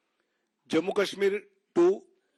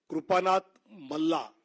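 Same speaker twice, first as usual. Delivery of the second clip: slow, deliberate list announcement with long pauses; slow name reading. Real, not acted. Announcing candidates' names to the press, slowly and deliberately. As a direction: read each name out slowly, pausing between items. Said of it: "मैं धीरे-धीरे नाम पढ़ता हूं".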